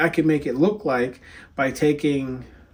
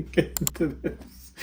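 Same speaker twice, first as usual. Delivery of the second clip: laughing; 4 semitones higher, slower than usual. Real, not acted. Laughing at own restart.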